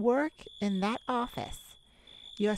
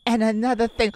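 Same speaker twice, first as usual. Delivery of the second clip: louder scolding start; raised scold; 8 dB louder, faster than usual. Acted. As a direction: bark out the next complaint. Scolding escalates.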